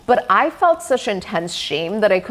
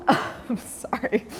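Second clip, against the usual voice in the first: laughing apology; 3 semitones higher, 8 dB quieter, much slower than usual. Real, not acted. Laughing at her own mess.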